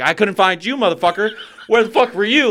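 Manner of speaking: high, loud, frantic outburst; angry yell. Retold or imitated. Parent who just found the lost child, frantic yelling.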